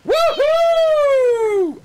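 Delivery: loud, high whoop of delight; excited cheer. Real, not acted. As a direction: cheer like a winning fan. Cheering at the picture.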